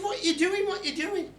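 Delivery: squeaky high questioning voice; high mock voice. Man puzzled by the leprechaun, high squeaky voice.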